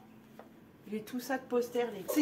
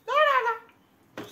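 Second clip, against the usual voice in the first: excited; 14 semitones higher, 7 dB louder, much slower than usual. Real, not acted. Thrilled by old memories.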